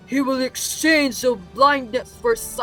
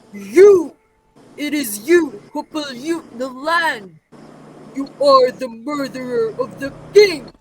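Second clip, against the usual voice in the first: higher, emphatic accusation; forceful accusation; 3 semitones higher, 4 dB louder than usual. Acted. Prophet turns the murder charge on the king, forceful. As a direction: pronounce the charge hard, voice raised.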